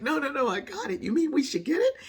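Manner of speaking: high, loud retold back-and-forth; surprised protest. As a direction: protest high and loud, then ask in disbelief. Acts out brother's surprised reply.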